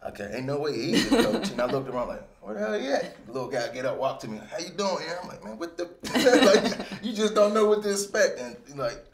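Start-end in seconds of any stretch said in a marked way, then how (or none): 0.1-1.5 laughing disbelief. Retold or imitated. Disbelief on meeting young coach. 2.4-3.3 high, puzzled where-is-he; a high, baffled question about a missing coach; puzzled mock search. Looking for the coach. 4.5-5.2 imitating young coach. Young coach greets him. 5.4-8.4 high, loud laughing disbelief; breaking into laughter, much higher and louder; laughing shock. Real, not acted. Shock at meeting coach.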